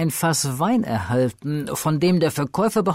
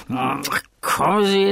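put-on drunken dismissal. Fortunato dismisses the cold.

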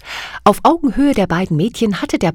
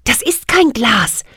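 usual speaking voice

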